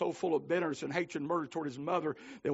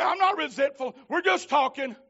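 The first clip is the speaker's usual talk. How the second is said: loud, high, insistent denial; defensive retort; 10 semitones higher, 8 dB louder than usual. Retold or imitated. Husband insisting to his wife they're just talking, raised voice. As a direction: brush it off, raised voice.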